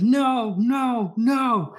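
high, frantic denials like a tantrum. Retold or imitated. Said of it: "he's pounding the tent floor".

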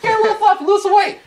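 mocking echo. Echoing his friend's fluffy remark, mocking high. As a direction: throw his phrase back high.

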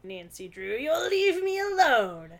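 high, loud witchy threat; cackling witch voice. Acted. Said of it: "in a cackling witch-like tone".

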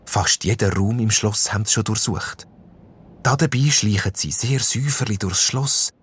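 plain reading voice

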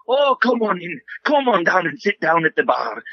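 high, lilting Scottish dwarf bartender; Scottish accent. Retold or imitated. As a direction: welcome them in with a hammy Scottish brogue. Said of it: "like, Scottish".

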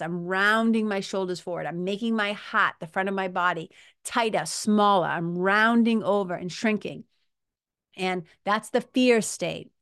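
ordinary talk, nothing marked

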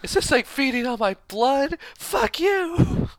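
high, loud mock scream; shrieking outburst. Quoting a movie character's outburst.

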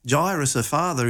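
ordinary talk

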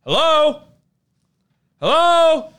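loud, high wary call into the dark. Retold or imitated. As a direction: call out to an unseen intruder. Calling out into dark warehouse.

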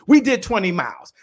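ordinary talk, nothing marked

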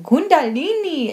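mimicking a friend repeating Kundalini loudly in a strange accent. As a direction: sing it out like a tripping friend. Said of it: "in this really strange accent".

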